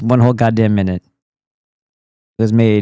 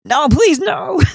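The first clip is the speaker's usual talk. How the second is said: high squealing plea, much higher; mock pleading victim; slower than usual. Streamer acting out a tortured viewer, high squealing plea. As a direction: beg like a tortured kid. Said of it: "torturing you like Cartman".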